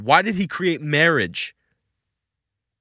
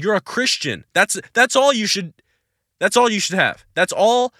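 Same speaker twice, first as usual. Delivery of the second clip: ordinary delivery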